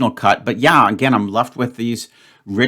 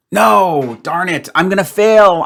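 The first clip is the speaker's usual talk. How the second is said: frustrated outburst; 8 semitones higher, 5 dB louder, slower than usual. Real, not acted. Frustrated at failing the puzzle.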